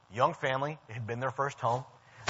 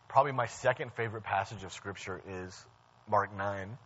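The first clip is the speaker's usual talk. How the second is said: usual speaking voice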